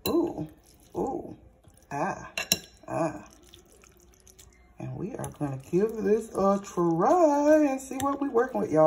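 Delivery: ordinary delivery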